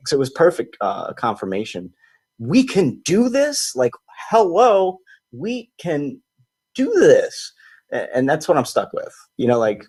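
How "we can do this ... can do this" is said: high, slow, drawn-out wonder; stretched, astonished exclamation; amazed disbelief. Real, not acted.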